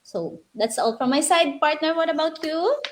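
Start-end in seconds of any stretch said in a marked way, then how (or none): none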